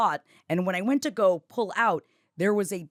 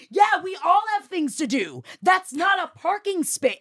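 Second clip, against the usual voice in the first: high, raised-voice re-enacted telling-off; indignant scolding; 10 semitones higher, 3 dB louder than usual. Ranter confronting the bad parker, indignantly loud. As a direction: scold at a high pitch, fed up.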